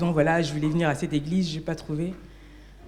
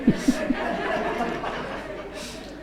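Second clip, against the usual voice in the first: breathy laugh; 5 semitones higher, 4 dB quieter than usual. Real, not acted. Laughs while recalling going home.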